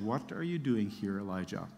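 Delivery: soft, low and fading, a still small voice; hushed voice of God barely above a breath; gentle whisper voice. Acted. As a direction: let it sink almost to nothing. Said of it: "sound of a gentle whisper".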